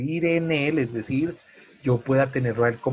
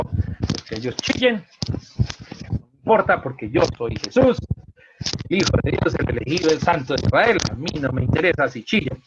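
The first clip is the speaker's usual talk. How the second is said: loud, raised, self-important declaration; mock haughty indifference; 3 semitones higher, 4 dB louder than usual. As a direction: brush them off grandly, hammed-up. Mock speech of an indifferent Jesus. (altivez fingida, indiferente)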